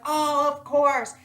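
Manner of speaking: high, slow, loud gushing "of course"; eager passenger. Retold or imitated. Passenger reassuring new driver, gushing and high. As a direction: lay it on with delight.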